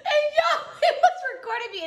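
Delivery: high, fast outburst through laughter; cracking up. Real, not acted. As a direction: blurt it out while laughing hard. Cracks up at her own story.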